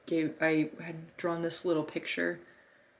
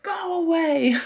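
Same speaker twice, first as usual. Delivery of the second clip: high, loud shout of get back; a retold high, loud scream at snarling dogs; retold yell at dogs; 11 semitones higher, 9 dB louder, slower than usual. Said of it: "screaming at them".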